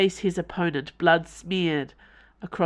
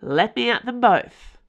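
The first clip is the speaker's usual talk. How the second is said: angry shout; 6 semitones higher, 6 dB louder than usual. Acted. Porthos charging angrily.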